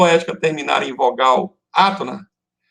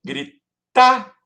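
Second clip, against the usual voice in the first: "Grita" split, the strong syllable pitched high; strong-syllable demo; 8 semitones higher, 3 dB louder than usual. Shown as a demonstration. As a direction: punch out the stressed syllable of "Grita", rising. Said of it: "qual é a sílaba mais forte?".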